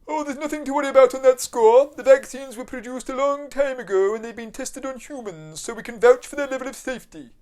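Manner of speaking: smooth reassuring official; official spokesman voice. Acted.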